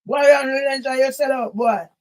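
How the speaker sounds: loud scolding jeers; angry rant. Real, not acted. Scolding a man for selling out, loud jeering. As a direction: berate him, punch each sell out. Berating someone as sellout.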